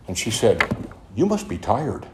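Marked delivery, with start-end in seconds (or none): 1.1-2.1 soft, gentle voice of a mild, meek lady; quiet gentle sponsor. Retold or imitated. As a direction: say it softly, like the meek sponsor who is not shocked. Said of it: "but a very quiet lady".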